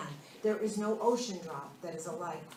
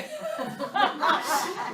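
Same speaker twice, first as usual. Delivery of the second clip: hearty laugh; 9 semitones higher, 6 dB louder than usual. Real, not acted. Amused speaker laughing with co-host at her question, hearty laugh. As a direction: burst of loud, high laughter.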